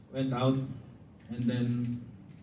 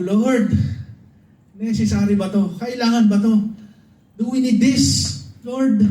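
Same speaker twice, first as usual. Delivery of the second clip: much higher and louder retold cry; grieving father shouts his question up to God; shouting at God; 9 semitones higher, 5 dB louder than usual. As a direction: cry out to the Lord, high and loud. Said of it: "sumisigaw ako ng malakas".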